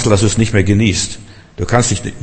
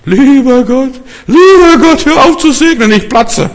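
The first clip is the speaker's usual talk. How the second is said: high, much louder retold outcry; loud cry; 7 dB louder than usual. A man at a Berlin service begging God to stop blessing, high loud cry. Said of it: "Dann sagt er ganz laut".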